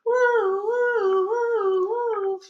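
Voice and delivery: high, loud wailing siren woo; be the police siren. Acted. Siren imitated.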